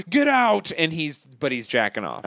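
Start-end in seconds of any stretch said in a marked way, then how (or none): 0.1-0.7 high, sudden yell to get out; startled shout. Retold or imitated. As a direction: shout as if caught off guard. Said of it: "And he's like".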